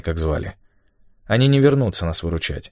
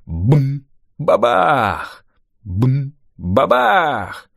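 raised short boom, then drawn falling blast; imitated explosions; 6 semitones higher, much slower than usual. A narrator's reading. Narrator mimics Rafael firing grenades, booming. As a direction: sound out each shot and its blast.

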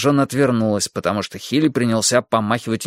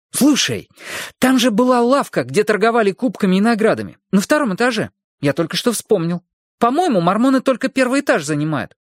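put-on high, eager boy blurting it out; a boy bursting with a new idea; excited boy voice; 9 semitones higher than usual. Boy character Hilly excited. (взволнованный мальчишеский голос)

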